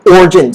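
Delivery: "origin" squeezed to "OR-jin"; silent I. Shown as a demonstration. Teacher repeats the correct word, "origin" as "OR-jin". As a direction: say "origin" without its middle i. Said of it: "The I is a silent I".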